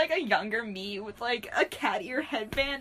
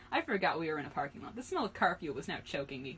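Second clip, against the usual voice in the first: usual speaking voice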